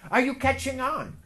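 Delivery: ordinary talk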